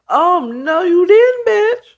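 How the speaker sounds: high, rising mock indignation; outraged mom. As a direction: act out a scandalized mother, pitch climbing. Acted mother outraged at the betrayal.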